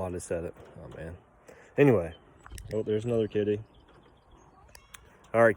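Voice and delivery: normal talking voice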